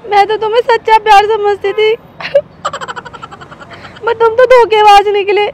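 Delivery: loud, hammed-up cry of betrayal at a cheating boyfriend; mock heartbroken shout. Girlfriend accusing her boyfriend of cheating during a prank, hammed-up heartbroken shout. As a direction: wail it out like a wronged lover in a soap.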